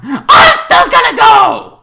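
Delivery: high, loud, slow shout with a laugh; determined shout. Retold or imitated. Retelling to viewers his choice to keep driving, shouted with a laugh. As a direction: yell your resolve, half laughing. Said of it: "I went like".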